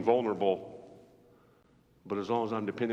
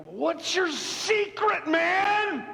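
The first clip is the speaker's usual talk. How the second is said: much higher and louder, drawn out, ending in a shout; awed fan's question; 6 dB louder, much slower than usual. Acted. A fan in heaven's line asks Samson his secret, squealing high. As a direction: gush at him slowly, then yell the last word. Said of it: "And you look at him, like".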